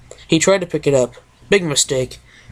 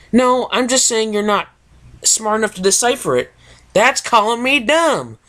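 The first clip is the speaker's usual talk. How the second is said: bickering pony; 7 semitones higher than usual. Acted. Characters arguing in story.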